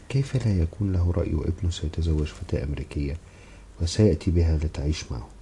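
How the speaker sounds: usual speaking voice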